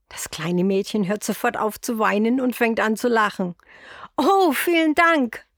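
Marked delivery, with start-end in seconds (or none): 4.2-5.5 a high, slow, delighted cry of thanks; louder, higher little girl thanking gladly; happy thanks. A narrator's reading. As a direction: call out your thanks, overjoyed. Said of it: "ruft es glücklich".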